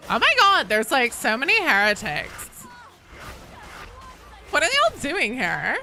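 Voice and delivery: high, exaggerated Valley Girl with swooping pitch. Streamer mocking all the heretics in game, Valley Girl voice. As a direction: play up a ditzy Valley Girl. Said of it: "Valley Girl voice".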